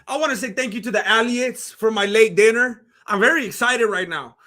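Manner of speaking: ordinary talk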